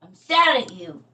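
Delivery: put-on high, loud little-kid pout; child's sulky complaint. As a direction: play the pouting child telling Mom off. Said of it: "I mean, they was mad".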